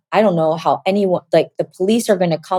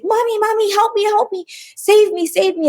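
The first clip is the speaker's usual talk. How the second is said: very high, pleading child imitation; little kid's voice; 13 semitones higher than usual. Voice coming out of her begging for help, little kid. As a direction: cry for mommy like a little child. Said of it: "like a little kid's voice".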